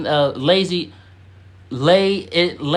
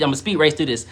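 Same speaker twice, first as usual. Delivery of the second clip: ordinary talk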